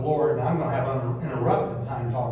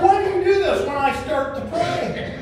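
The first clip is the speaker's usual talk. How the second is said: much higher and louder, laughing through the exasperation; exasperated plea to the cat; 13 semitones higher, 6 dB louder than usual. Retold or imitated. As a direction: beg the noisy cat to stop, half laughing. Pastor pleads with noisy cat.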